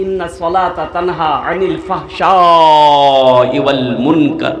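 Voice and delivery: slow, chanted Arabic verse; Quranic recitation. Acted. Preacher chanting the verse to the congregation, slow and solemn. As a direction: intone the verse slowly like a recitation.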